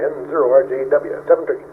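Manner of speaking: ordinary talk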